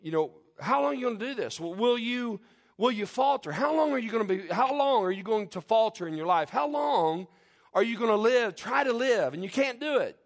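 high, loud, full-force preaching; fiery preaching. Acted. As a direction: preach it out with fire. Fiery sermon climax, raised voice.

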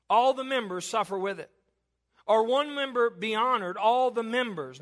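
"all" and "members" hit firmly each time; emphasis on all. Shown as a demonstration. Preacher stressing "all the members" to a divided church. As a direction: drive home "all the members" by repeating it. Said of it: "Notice the emphasis".

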